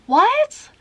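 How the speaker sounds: a sharp, very high "What?"; irritated shocked squeak; retold disbelief. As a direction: replay the shock of hearing it broke again. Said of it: "I'm getting irritated".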